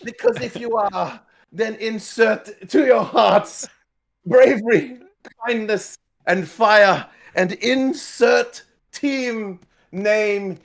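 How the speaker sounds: high, loud, slow hype call; rally the crowd; rousing crowd shout. Acted. Character hypes the crowd.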